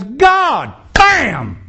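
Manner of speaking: high, punched two-word burst; punchy exclamation. Acted. Prophet calling down fire with a short prayer, punchy. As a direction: fire it out, each word falling from a high start.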